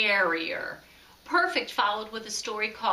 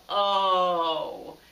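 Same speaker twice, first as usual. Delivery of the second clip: 1 disappointed chorus; 4 semitones lower, 3 dB louder than usual. A narrator's reading. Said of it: "then a chorus of".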